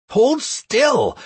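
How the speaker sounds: high, loud command; shouting. Acted. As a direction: shout the order urgently. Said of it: "I shouted to the wriggling woman".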